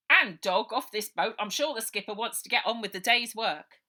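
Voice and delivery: loud, fast policeman's voice; old sergeant herding the children; bluff old copper. Acted. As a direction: settle them down officiously. Sergeant orders off.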